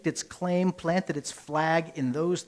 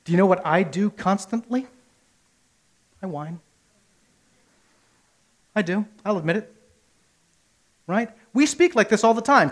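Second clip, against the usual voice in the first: ordinary talk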